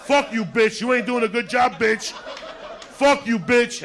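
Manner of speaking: high, loud mock tirade; acted angry shout. Friend cursing the woman in the car, acted high angry shout. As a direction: yell the abuse at full comic force.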